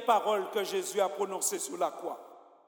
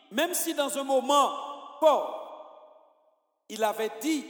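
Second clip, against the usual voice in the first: ordinary talk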